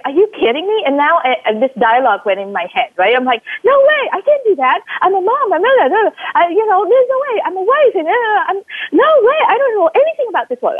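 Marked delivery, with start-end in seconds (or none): 0.0-0.8 incredulous. Retold or imitated. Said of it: "I was like". 3.6-10.8 much higher, fast, frantic self-talk; panicked protest. Retold or imitated. Mother arguing with her inner voice, high frantic panic.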